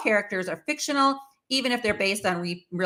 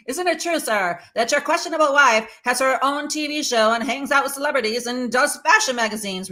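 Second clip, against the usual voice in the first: mock talk show host; 5 semitones higher, 4 dB louder than usual. Spoof talk show host quoted.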